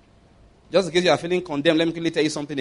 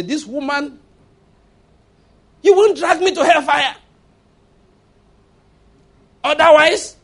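high, loud mock threat to a wife; hammed-up, high-pitched husband's warning; acted angry husband; 10 semitones higher, 7 dB louder than usual. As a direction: shout it as a fed-up husband laying down the law.

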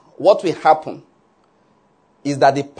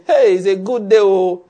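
loud, higher-pitched mock greeting; grating morning greeting; 4 semitones higher, 4 dB louder than usual. Wife greets husband in the morning, grating and loud. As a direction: call out an irritatingly hearty good morning. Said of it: "It makes my head ache".

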